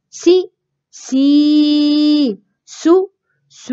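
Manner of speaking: سي then سي, سو then سو, second one stretched; short then long. Shown as a demonstration. Teacher reviews short and long vowels with the class. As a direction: clip the first, draw out the second. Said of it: "نراجع قصيرة وطويلة".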